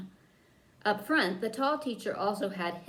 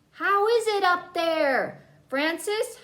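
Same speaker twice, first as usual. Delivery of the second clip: high, loud, slow call upward; 9 semitones higher, 6 dB louder, slower than usual. A narrator's reading. Boy calls up through the trap door to Frances. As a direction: call up into the attic. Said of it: "Howard called out".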